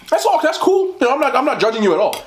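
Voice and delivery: breezy reassurance. Real, not acted. Telling dog-dressing viewers it's fine, high breezy reassurance. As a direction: wave it off in a high, light voice.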